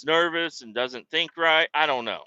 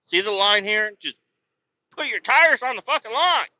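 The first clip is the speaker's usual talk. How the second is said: exasperated scolding; 8 semitones higher, 5 dB louder than usual. Retold or imitated. Scolding the driver about lines.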